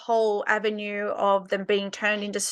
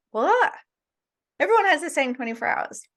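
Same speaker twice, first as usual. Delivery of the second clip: higher-pitched, mock-baffled "people" voice; mock incredulous objection; 5 semitones higher than usual.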